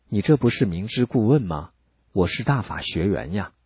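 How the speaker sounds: usual speaking voice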